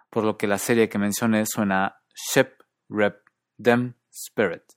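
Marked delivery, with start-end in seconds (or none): 2.1-4.6 SHIP, RIP, DIM, SPIRIT with centralized New Zealand vowel; New Zealand ship, rip, dim, spirit, vowel like 'uh'; New Zealand accent. Shown as a demonstration. Teacher giving example words, New Zealand centralized I in each. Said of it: "se pronuncia centralizada".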